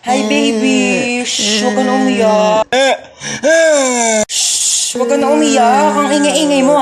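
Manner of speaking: slow, tender hushing; soothing a baby. Acted. Mother comforting her crying newborn, tender and slow.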